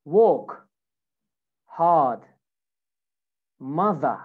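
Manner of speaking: British "Walk, hard, mother" with each R dropped; non-rhotic British demo of "Walk, hard, mother"; British accent. Shown as a demonstration. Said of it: "British version".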